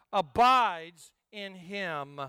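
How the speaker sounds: slow solemn scripture voice; slow deliberate reading. Shown as a demonstration. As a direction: stretch each word out slowly.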